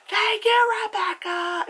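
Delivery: very high, loud mock shout; obnoxious. Friend calls out to the hostess, obnoxious. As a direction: blare it out high and showy. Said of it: "it's, like, so obnoxious".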